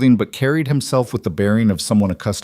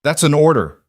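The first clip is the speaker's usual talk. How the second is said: loud command; 7 semitones higher, 5 dB louder than usual. A narrator's reading. Captain commanding.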